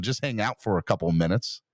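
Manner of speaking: ordinary talk, nothing marked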